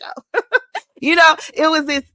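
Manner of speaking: laughing. Real, not acted. Laughs at her own story.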